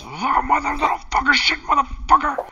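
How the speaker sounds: high shrieking curses; screeching curse. Acted. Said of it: "She goes".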